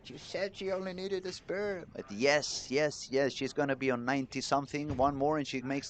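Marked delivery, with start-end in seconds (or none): 0.1-1.9 mock outraged audience. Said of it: "the audience drops down like".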